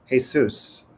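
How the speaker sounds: ordinary talk, nothing marked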